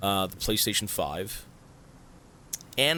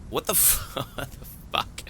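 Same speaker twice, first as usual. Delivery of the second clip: laughing disbelief; 2 semitones higher, 4 dB quieter than usual. Real, not acted.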